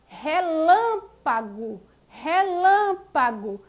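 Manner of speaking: "relâmpago" slowly, with a stretched LÂM; stressed syllable shown. Shown as a demonstration. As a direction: lean on LÂM in "relâmpago", letting the ending fall away. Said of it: "onde se localiza a sílaba mais forte".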